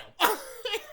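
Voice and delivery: loud, high burst of laughter. Real, not acted. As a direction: crack up loudly. Laughing at gore scene.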